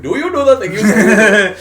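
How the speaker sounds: put-on loud comic Indian accent. Comic tries out his bit on a friend, hammed-up Indian accent. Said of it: "you're doing an Indian accent".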